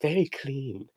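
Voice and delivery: high, soft British accent, imitating; mock British accent. Podcaster quoting the film's running joke, mock British accent. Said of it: "why am I talking like this?".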